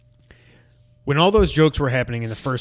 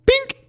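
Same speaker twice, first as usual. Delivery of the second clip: high, squeaky "bink" sound effect; cartoonish little bonk noise; imitated bonk; 4 dB louder than usual. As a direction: make a sudden high-pitched bink for a hit on the head.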